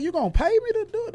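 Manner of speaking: high, fast, astonished question; amazed disbelief. Retold or imitated. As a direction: rush it out in happy shock. Disbelief at being paid more.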